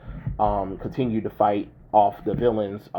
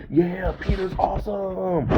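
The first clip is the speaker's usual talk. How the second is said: high, adoring voice; adoring Deadpools; 9 semitones higher than usual. Retold or imitated. Deadpools distracted, gushing high over Peter. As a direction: gush like awed fans. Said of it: "they're like".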